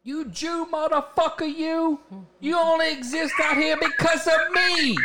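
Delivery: high, hammed-up mobster rant; Joe Pesci. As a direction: snarl like an angry wiseguy. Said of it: "Doing that Joe Pesci".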